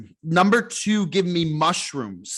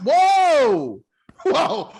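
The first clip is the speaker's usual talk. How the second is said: high excited whoa with laughter; excited shouting; 10 semitones higher, 4 dB louder, much slower than usual. Real, not acted. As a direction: yell it out at the big play. Cheering a big play live.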